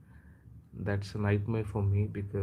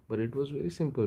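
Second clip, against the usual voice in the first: normal talking voice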